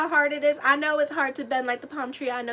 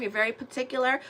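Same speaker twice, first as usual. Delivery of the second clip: ordinary talk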